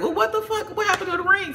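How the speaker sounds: very high, squealed protest; high, indignant squeal; boisterous outrage. Retold or imitated. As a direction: shriek it like the friend who won't hush. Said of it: "Damien is very boisterous".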